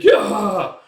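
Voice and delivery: high, loud mock scream; pained scream. Pirate takes a blow to the head, pained scream. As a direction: cry out as if struck.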